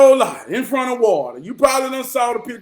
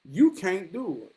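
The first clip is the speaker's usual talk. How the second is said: softer, lightly voiced granddad; granddad's voice; 3 semitones lower, 7 dB quieter than usual. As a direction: quote granddad's challenge quietly. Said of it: "through my granddad voice".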